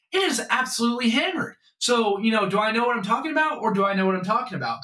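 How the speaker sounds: high, fast gloating; excited bragging. Real, not acted. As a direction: crow about being right, fast and high. Bragging about his winning bets.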